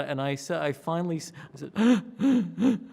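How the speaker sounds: normal talking voice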